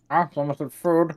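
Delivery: talking through a mouthful; slow, chewing on jerky; mouth full. Acted. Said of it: "jerky meat in my mouth".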